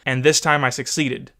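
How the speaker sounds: ordinary delivery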